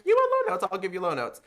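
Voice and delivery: defiant challenge. Retold or imitated. Singer answering the judge's request, defiantly loud and high. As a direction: throw the challenge right back. Defiant after "fighting for your life.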